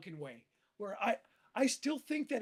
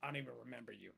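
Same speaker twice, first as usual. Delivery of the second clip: low, soft dismissal; mock cocky indifference, understated; cocky brush-off; 8 semitones lower, 8 dB quieter, much faster than usual. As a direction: imitate someone acting too cool to care. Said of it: "tried to be all cocky".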